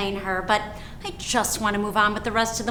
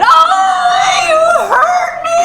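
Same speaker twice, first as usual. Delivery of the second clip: very high, loud drawn-out wail; child's wail; 8 dB louder, slower than usual. Acted. Daughter whose nose was pinched too hard, wailing. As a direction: cry out like a hurt little girl. Hurt child wails at mother.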